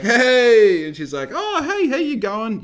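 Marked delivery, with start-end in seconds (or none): 0.0-0.9 loud, high greeting hey; big excited hey. Retold or imitated. Walking in greeting the shop owner, loud excited hey. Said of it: "I was like". 1.3-2.6 high, loud cheery hello; jolly greeting. Retold or imitated. Shop owner greeting him back, high jolly hello. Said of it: "she's always jolly".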